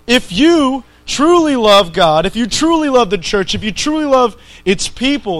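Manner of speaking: stack the ifs, each one pressed; building preacher appeal. Real, not acted. Preacher pleading with the congregation, building intensity.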